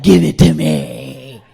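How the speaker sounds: loud, low, slow brute voice; greedy men. Acted. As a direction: demand it in a big slow comic voice. Men acted as greedy eaters.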